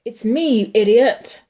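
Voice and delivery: loud, exasperated retort; shouted back. A narrator's reading. Man shouting back at his partner mid-scuffle. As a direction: make him recognize you, loudly. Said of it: "a second voice answered back".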